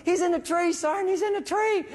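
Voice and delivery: put-on high excited young soldier voice; Floyd calling out. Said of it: "And Floyd's like".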